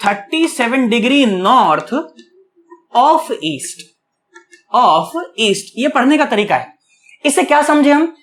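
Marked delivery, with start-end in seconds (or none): 0.1-5.7 slow, spaced-out reading with pauses between the parts; slow reading aloud. Shown as a demonstration. Teacher to students, how to read a direction: slow and spaced. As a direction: read it out word by word for the class. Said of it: "इसे ऐसे पढ़ा जाता है".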